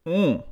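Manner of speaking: high satisfied mm after a sip; while sipping a drink. Real, not acted. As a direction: savor the sip with a high hum. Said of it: "Let me have a sip of water".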